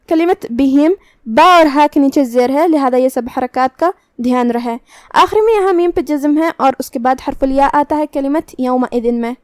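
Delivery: ordinary talk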